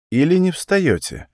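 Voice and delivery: high, ironic question; dry ironic ask. Acted. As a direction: tease him gently with a lifted question. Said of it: "спросил Гарану иронично".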